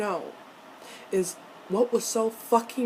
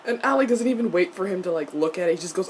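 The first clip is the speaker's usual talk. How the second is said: ordinary delivery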